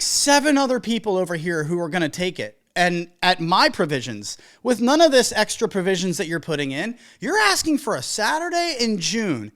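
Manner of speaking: usual speaking voice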